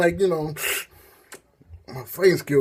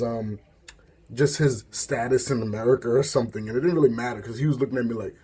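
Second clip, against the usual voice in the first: ordinary talk, nothing marked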